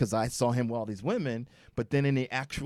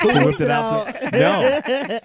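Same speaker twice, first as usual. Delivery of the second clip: high, loud, slow, laughing hard; can't get the words out for laughing; laughing through words; 10 semitones higher, 11 dB louder, much slower than usual. Real, not acted. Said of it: "What you say?".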